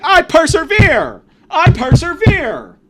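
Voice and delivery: drawn-out, high, very loud scream. Acted. Screaming at a friend in the pizza shop, defiant high scream. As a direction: bellow it slowly like a defiant battle cry. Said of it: "I kinda just scream that".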